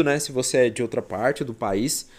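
usual speaking voice

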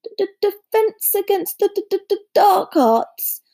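high, nervous stutter; timid professor tripping on consonants; stammered reluctance. A narrator's reading. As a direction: stammer as though unwilling to think about it. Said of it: "muttered Professor Quirrell".

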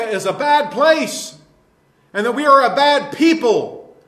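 fired-up pulpit preaching, hard stresses; indignant sermon delivery; forceful preaching. Real, not acted. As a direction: preach it with insistent force, punching key words. Preaches on a godless generation.

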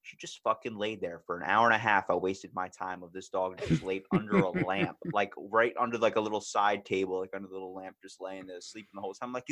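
ordinary delivery

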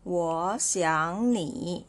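我 rising, 想 rising, 你 dipping; slow, drawn-out 我想你 with 我 and 想 lifted; second, second, third tone. Shown as a demonstration. Teacher modelling three third tones together, 我想 rising, slowly. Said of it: "so second tone, second tone and third tone".

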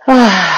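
loud releasing sigh out the mouth; audible sigh. Shown as a demonstration. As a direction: let the breath go in one big sigh. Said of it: "sácalo como un suspiro".

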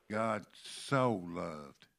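slow, "so" drawn out and leaned on; stress on SO. Shown as a demonstration. Preacher recalling the word that jumped out, stress on SO. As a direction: hold "so" long, landing on it hard. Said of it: "that word so".

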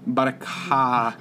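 slow, drawn-out Boston "car" with no r; Boston accent. Retold or imitated. Echoing the cohost's joke about locals, drawn-out Boston accent. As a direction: stretch "car" into a Boston "cah". Echoes the mock Boston phrase.

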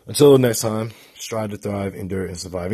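a hyped sign-off chant; sign-off mantra. Real, not acted. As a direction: belt out the channel motto. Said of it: "I've been saying this mantra".

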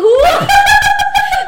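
loud, high-pitched burst of laughter; uproarious laugh. Real, not acted. Player cracks up over the copied surname, uproarious. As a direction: crack up loudly.

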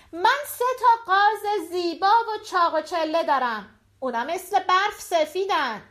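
high-pitched vendor's call, little extra volume; market cry. Acted. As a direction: call out your wares to the crowd. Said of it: "روبین رفت روی یک بلندی و فریاد زد".

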